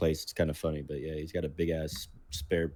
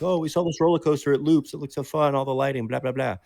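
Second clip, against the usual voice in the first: quick, bubbly guest voice; excited park guest; 7 semitones higher, 5 dB louder than usual. Retold or imitated. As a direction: gush like a thrilled visitor.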